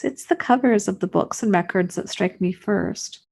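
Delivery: usual speaking voice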